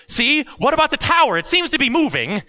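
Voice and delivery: put-on a frightened boy pointing at danger; panicky kid. Boy panics as tower shifts.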